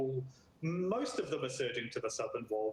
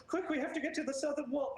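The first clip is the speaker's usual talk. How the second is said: urgent guard; 13 semitones higher than usual. Acted. Guard urges retreat.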